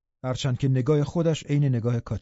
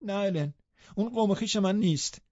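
high-pitched, wounded denial; aggrieved girl refusing to accept it; renewed hurt; 8 semitones higher than usual. A narrator's reading. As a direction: protest in a high, upset voice. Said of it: "داغ دلش تازه شد و گفت".

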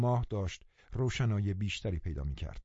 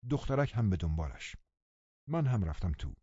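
ordinary delivery

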